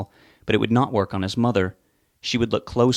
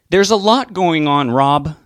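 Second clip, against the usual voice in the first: louder, higher, slow building anger; growing anger; 7 semitones higher, 8 dB louder, slower than usual. Acted. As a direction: let the anger rise. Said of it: "She was beginning to sound angry".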